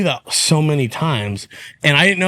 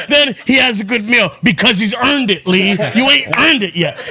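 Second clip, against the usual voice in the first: much higher, louder hyped rant; Joey's pumped-up voice; 7 semitones higher, 3 dB louder than usual. Retold or imitated. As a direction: preach at a friend like a fired-up coach. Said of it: "And he would say like that".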